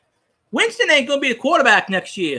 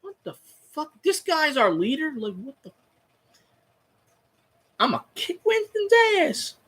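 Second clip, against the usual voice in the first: teammates' disbelief; 5 semitones higher, 6 dB quieter than usual. Retold or imitated. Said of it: "His own teammates looked at him like".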